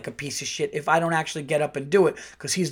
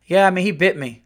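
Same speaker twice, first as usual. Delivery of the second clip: put-on matter-of-fact kid; 5 semitones higher, 6 dB louder than usual. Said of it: "She goes".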